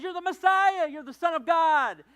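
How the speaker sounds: high, loud eager declaration; excited answer. Retold or imitated. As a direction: blurt it out like the star pupil. Peter answers Jesus excitedly.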